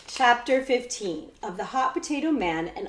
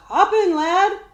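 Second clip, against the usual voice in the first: put-on hearty old coachman; 10 semitones higher, 9 dB louder than usual. Coachman invites Jemmy aboard.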